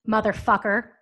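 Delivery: ordinary talk, nothing marked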